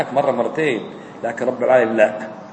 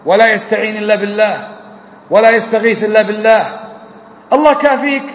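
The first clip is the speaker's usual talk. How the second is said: impassioned Friday sermon voice, rising and falling; urging the congregation to call on God alone, preached with fervor; preaching; 5 semitones higher than usual. Acted. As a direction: preach it to the hall with conviction.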